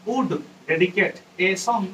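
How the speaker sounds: very slow, word by word; slow dictation. Shown as a demonstration. Teacher dictating the poem's line slowly to students. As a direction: dictate each word for the class.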